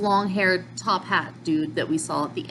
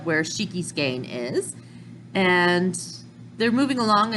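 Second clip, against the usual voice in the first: ordinary delivery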